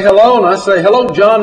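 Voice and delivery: high, loud, rising hello; lively phone greeting. Retold or imitated. Narrator picks up the phone to his friend, lively greeting. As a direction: answer the call high and loud. Answering John's excited phone call.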